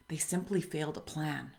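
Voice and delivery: whispering. Real, not acted. Punchline of a quoted saying.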